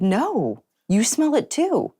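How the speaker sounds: imitating her own shocked reaction; startled disbelief. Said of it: "And I was like".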